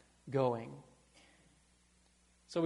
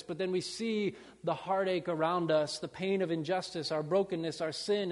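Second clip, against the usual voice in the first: ordinary talk, nothing marked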